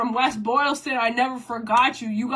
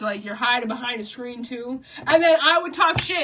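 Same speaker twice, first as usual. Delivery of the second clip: ordinary talk, nothing marked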